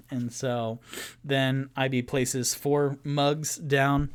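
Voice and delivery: usual speaking voice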